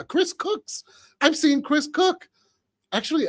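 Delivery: ordinary talk, nothing marked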